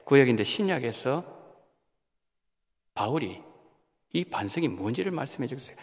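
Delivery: ordinary delivery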